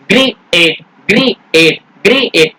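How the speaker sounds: ग्रीएट split into two separate beats, gree and ate; mock-wrong ग्रीएट, broken in the middle; broken-up mispronunciation. Shown as a demonstration. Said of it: "ऐसे बोलते हैं क्या?".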